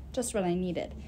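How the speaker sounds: ordinary delivery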